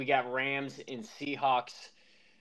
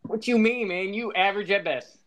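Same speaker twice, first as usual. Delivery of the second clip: mock indignant; 8 semitones higher, 7 dB louder than usual. Real, not acted. Trash-talk banter.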